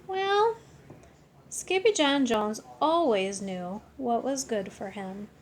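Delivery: ordinary delivery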